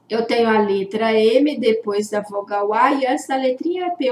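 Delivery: ordinary delivery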